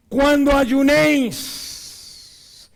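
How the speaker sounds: very slow, high and loud; drawn-out proclamation. Real, not acted. Preacher proclaiming the verse on fasting. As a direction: stretch each syllable out. Reading scripture emphatically.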